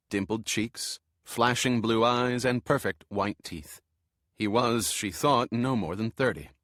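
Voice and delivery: ordinary delivery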